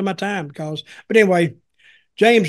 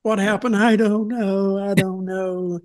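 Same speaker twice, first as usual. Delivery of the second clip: a slow, dazed imitation of a bride in tears; tearful confusion. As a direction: mimic her lost and teary at the back. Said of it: "she was breaking down in tears".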